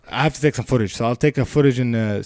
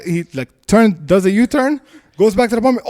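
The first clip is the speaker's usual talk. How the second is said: normal talking voice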